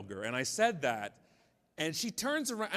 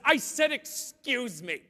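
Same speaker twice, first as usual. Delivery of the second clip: snippy mock tone; 7 semitones higher, 5 dB louder, slower than usual. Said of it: "which in a wonderful tone".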